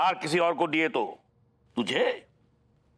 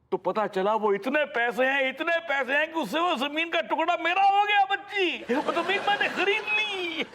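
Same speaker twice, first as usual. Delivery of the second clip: climbing ever higher to a jubilant peak; triumphant excitement; 11 semitones higher than usual. Acted. Father telling daughter he got the land, elated. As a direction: build to a high-pitched, exultant finish. Triumphant climax of his story.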